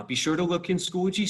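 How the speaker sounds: normal talking voice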